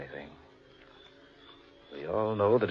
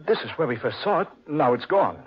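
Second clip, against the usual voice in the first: alarmed discovery; 9 semitones higher, 7 dB louder than usual. Acted. Discovering the tree has vanished.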